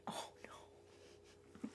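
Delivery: breathy startled whisper. Real, not acted. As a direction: hush it out, caught off guard. Asked to do a British accent.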